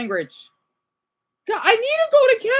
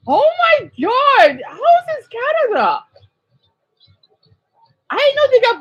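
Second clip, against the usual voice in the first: real astonished outburst; amazed; 10 semitones higher, 3 dB louder than usual. Real, not acted. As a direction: exclaim it high in disbelief. Amazed reaction to parade video.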